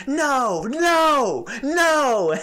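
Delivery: repeated high, loud shrieks; top-of-lungs yell. Retold or imitated. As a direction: imitate her screaming at full lungs. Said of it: "on the top of her lungs".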